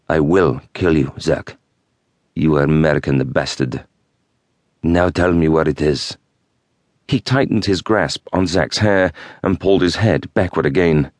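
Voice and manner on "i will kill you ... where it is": put-on threatening Middle Eastern accent. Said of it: "the resonant tone of his voice making him all the more threatening".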